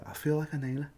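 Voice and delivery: high, put-on Scouse accent; obviously forced Scouse; too-fake Scouse. Shown as a demonstration. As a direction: overdo the Scouse, pitched well up. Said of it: "that's when you start to sound too fake".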